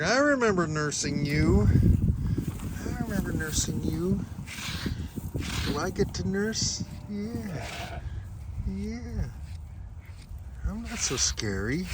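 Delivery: high, sweet voice for the mother sheep; voicing the ewe. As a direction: play the ewe talking to her baby, high and gentle. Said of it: "she's like".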